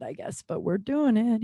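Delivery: ordinary talk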